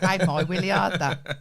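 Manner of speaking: laughing through the words. Real, not acted. Joking about willy, laughing.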